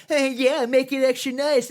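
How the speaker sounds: put-on high, loud, smug bratty kid; smug coworker voice. A cocky teen coworker ordering at the counter, in a high, loud bratty voice. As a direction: make it sound like a cocky punk teasing.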